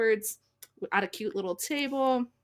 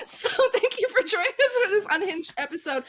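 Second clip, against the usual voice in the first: laughing; 9 semitones higher, 4 dB louder than usual. Real, not acted. Laughing through the sign-off.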